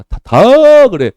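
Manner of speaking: 높고 크고 느리게 끊어 외침; 한 음절씩 크게 내리치듯; 강조하는 외침. Real, not acted. 학생들에 대한 강조. ("다"마다 끊어 높고 크게 외침)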